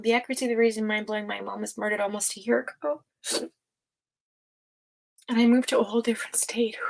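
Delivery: quick, hushed reading while moved; tearing up. Real, not acted. Host reading a viewer's message about grief, tearing up. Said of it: "I'm just tearing up a bit".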